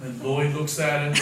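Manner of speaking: ordinary talk, nothing marked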